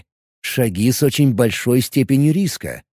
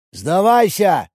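shouting priest; 6 semitones higher, slower than usual. Acted. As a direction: bark the order down at a cornered enemy. Dark priest demands surrender.

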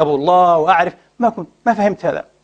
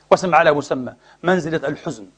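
normal talking voice

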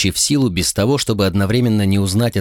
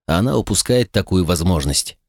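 usual speaking voice

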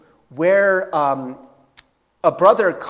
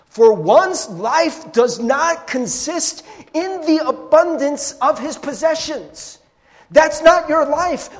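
higher, slow, emphatic preaching; fervent sermon climax; impassioned preaching; 10 semitones higher, 4 dB louder than usual. Acted. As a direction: drive it home with urgency. Preaching at full force.

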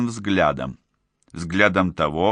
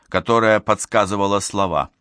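plain reading voice

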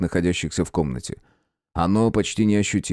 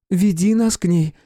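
put-on young woman's voice; 10 semitones higher, 3 dB louder than usual. A narrator's reading. Princess voiced by narrator.

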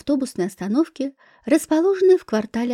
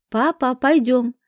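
plain reading voice

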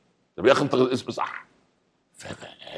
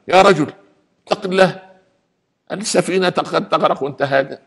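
panicked wife shouting; 8 semitones higher than usual. Retold or imitated. Wife grabs her calm husband in the storm: frantic high shout. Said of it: "زوجته بدأت تصرخ".

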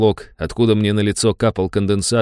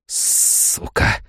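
voiceless breath-only outburst; exhaled curse; 5 dB quieter than usual. A narrator's reading. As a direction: blow the word out on the breath. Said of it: "выдохнул я". (выдохнуть ругательство)

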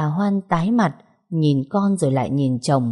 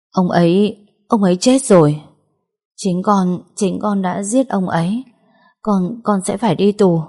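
louder, higher panicked outburst; 4 semitones higher, 4 dB louder than usual. Acted. Mother telling her daughter the father is dead, loud panic. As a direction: blurt it out in shock and fear.

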